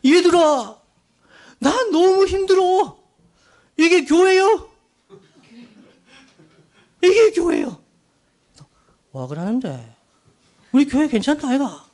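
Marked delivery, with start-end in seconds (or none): 0.1-7.8 loud, high-pitched imitation of a crying complaint; mock tearful outburst. Preacher acting his Jeolla friend complaining to churchmates, tearful outburst. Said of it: "울면서 얘기했어요". 10.7-11.9 higher-pitched imitated Gyeongsang dialect; Gyeongsang accent. Preacher voicing Gyeongsang churchgoers answering their friend, Gyeongsang accent. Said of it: "경상도 출신들이 이렇게 얘기한 거예요".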